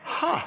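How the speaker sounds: short huh, then long breathy exhale; imitated stunned reaction. Church elder hearing a shocking confession, imitated stunned exhale. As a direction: act out a flabbergasted, wordless reaction. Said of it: "this is exactly what he does".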